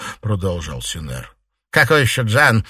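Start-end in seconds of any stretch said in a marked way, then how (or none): 1.7-2.7 loud, raised exasperated question; losing her temper. A narrator's reading. Mistress hearing an unknown name, losing her temper. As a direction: snap the question out loudly. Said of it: "вышла из себя Фэнзе".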